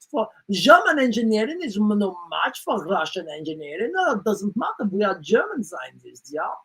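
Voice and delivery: cartoon German accent, only a hint of it; mock German accent. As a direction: play a boastful German officer with a hammed-up German accent. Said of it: "does that sound like I've done that too many times".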